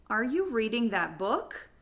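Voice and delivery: "book" swoops up at the end; yes-or-no question with pitch climbing on "book"; rising end intonation. Shown as a demonstration. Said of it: "intonation will go up at the end".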